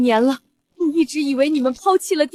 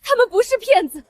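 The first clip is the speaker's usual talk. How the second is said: usual speaking voice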